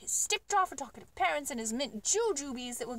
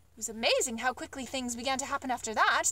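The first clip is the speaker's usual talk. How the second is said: ordinary delivery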